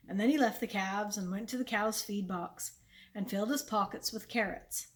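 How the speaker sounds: ordinary delivery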